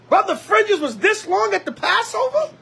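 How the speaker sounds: usual speaking voice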